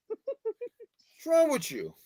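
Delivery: playful high slow pet-scold; mock scolding baby talk. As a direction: tease the cat in a silly high voice.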